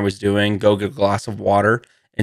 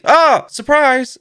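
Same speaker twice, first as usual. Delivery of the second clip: mock surprise; 13 semitones higher, 4 dB louder, slower than usual. Acted surprise reveal.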